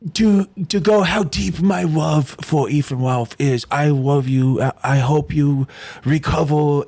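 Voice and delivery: put-on slow baby-talk adoration, r's and l's as w's; mocking fanboy. Host making fun of how Ralph's fans adore him, mock fanboy. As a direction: gush in a hammed-up, drawn-out lisping voice. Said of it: "that time I was making fun?".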